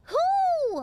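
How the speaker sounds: a loud breathy whoo to shake off tears; shaky exhale. Real, not acted. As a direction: blow out the emotion in one high breath. Composing himself after tears.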